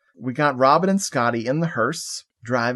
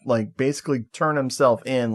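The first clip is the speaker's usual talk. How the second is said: ordinary talk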